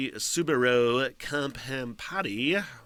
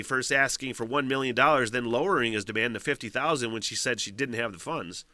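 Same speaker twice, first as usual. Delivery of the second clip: ordinary talk, nothing marked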